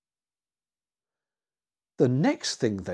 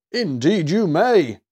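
loud, slow, higher hearty consent; big drawn-out storybook Santa yes; 5 semitones higher, 6 dB louder than usual. A narrator's reading. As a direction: grant it with jolly emphasis. Santa grants the boy's request.

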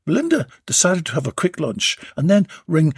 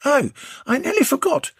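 woman voice; 14 semitones higher than usual. A narrator's reading. Belinda voiced in dialogue.

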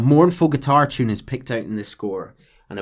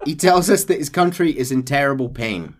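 ordinary talk

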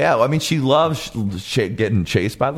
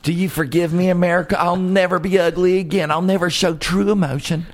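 higher-pitched mock plea; lightly voiced pleading star; 6 semitones higher than usual. Pop star begging America's forgiveness, high mock pleading. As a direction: plead a little theatrically, pitched up.

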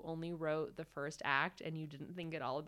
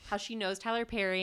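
ordinary talk

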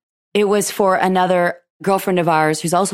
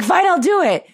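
much higher, louder fine I'll do it; exasperated giving in; 11 semitones higher, 3 dB louder than usual. Retold or imitated. Giving in to the pushy friend, much higher exasperated. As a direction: cave in with a huff.

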